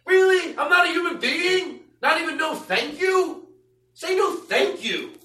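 high whining, slow and aggrieved; whiny complaining man. Retold or imitated. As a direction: complain like a wounded loser. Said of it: "That's him complaining to his friends".

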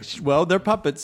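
ordinary delivery

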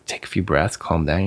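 ordinary talk, nothing marked

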